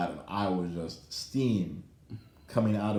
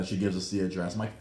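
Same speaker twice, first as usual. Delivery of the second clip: ordinary talk, nothing marked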